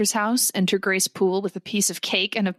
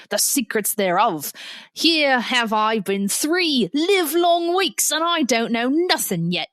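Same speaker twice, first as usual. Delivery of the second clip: hammy, badly faked British accent; horrible British accent. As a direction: play the indignant servant in a terrible British accent. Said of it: "forgive me for this horrible accent".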